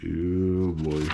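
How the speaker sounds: ordinary delivery, nothing marked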